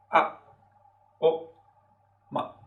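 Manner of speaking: a, o, ma very brief and dry; short neutral tone. Shown as a demonstration. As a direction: clip each syllable short and light. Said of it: "Es muy breve y muy seco".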